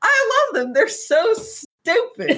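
high, slow, adoring drawl; fond gush. Real, not acted. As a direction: swoon over the two idiots. Fond gushing about the pair.

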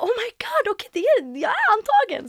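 very high, overjoyed squeal; joy breaking through the shock. Retold or imitated. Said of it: "Jag bara". (förtjust, väldigt ljust pip)

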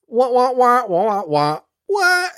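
high whiny wah wah wah; sing-song mock wail. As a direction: wail it like a cartoon alarm. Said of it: "you can almost hear the".

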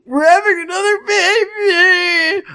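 hammed-up high slow sob; mock crying. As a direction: wail it in a fake cry. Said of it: "I said with tears going down my face".

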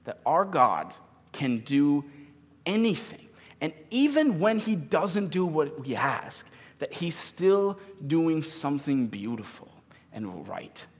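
slow, loud, emphatic sermon delivery; impassioned preaching. Real, not acted. Preacher urging believers to trust God, impassioned. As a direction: drive each word home to the congregation.

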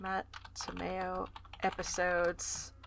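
slow, low murmur while writing; soft, halting self-dictation; absent-minded dictation. Real, not acted. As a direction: mutter it slowly as you note it down.

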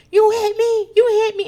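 high, loud outraged yell, imitated; mock scream. Recapper acting out a woman attacked, mock scream. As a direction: imitate someone screaming an accusation. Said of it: "Ramona is screaming".

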